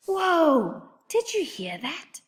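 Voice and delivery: hushed, breathless whisper; scared whisper. Acted. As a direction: whisper it, spooked by the voice. Startled by a talking stump.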